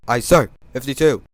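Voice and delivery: loud, keen sailor's reply; eager aye. Acted. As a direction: bark it back like a deckhand. Engine accepts an order.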